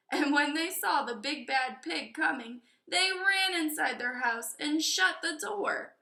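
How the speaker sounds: ordinary delivery, nothing marked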